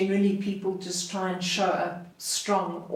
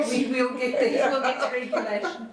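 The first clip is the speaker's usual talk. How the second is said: laughing; 5 semitones higher, faster than usual. Real, not acted. Laughing at gum joke.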